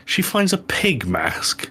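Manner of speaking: usual speaking voice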